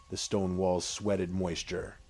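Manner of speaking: plain reading voice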